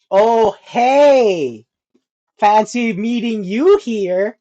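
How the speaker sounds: loud, drawn-out mock greeting; exaggerated fake-friendly hello, stretched out; date's pointed greeting. Voicing the date at the register.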